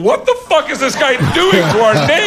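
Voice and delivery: loud, high-pitched indignant cry; mock outrage. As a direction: play the appalled onlookers in heaven. Outraged heroines in heaven.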